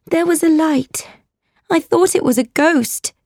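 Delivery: put-on high, scared child's voice; alarmed, high-pitched explanation; frightened cry. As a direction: blurt out the fright, pitched well up. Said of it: "I cried".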